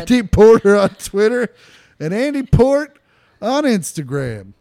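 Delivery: giggling while fixing the handles; laughing correction. Real, not acted. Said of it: "exactly what you just said".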